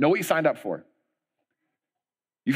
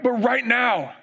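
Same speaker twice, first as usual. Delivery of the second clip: hyped rallying cry; 11 semitones higher than usual. Retold or imitated. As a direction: fire them up.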